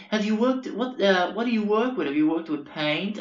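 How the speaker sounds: put-on high-pitched, inquisitive Long Island boyo; Long Island accent. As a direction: quiz him as an eager Long Islander. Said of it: "real Long Island boyos".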